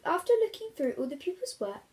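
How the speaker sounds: plain reading voice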